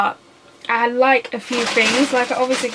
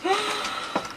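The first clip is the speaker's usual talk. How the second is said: sharp gasp; 10 semitones higher, 8 dB quieter than usual. Real, not acted. Wrong sauce discovered.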